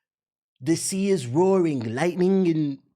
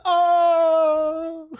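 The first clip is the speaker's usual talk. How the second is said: long, loud, high-pitched mock "oh"; imitated cry; 11 semitones higher, 5 dB louder than usual. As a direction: hold a high wail as the struck villain. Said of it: "She said".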